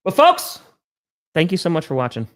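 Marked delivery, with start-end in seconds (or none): 0.1-0.6 high, loud show sign-off; announcer voice. Acted. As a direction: call it out to the audience. Host signs off the show.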